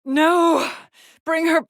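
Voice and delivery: loud, high cry of refusal; anguished cry. Acted. Cries out in anguish.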